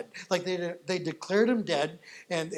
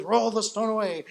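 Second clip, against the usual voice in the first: raised, higher voice of command; 4 semitones higher, 6 dB louder than usual. Acted. Jesus to the mourners at the tomb, commanding. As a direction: order it done as Jesus at the tomb.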